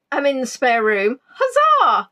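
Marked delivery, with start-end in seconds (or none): 1.3-2.1 a high, jokey little cheer of victory; mock triumphant cheer. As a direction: cheer it like a pantomime hero. Mock triumph in spare room.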